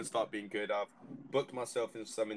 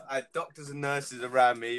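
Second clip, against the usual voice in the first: ordinary delivery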